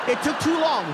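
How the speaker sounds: ordinary talk, nothing marked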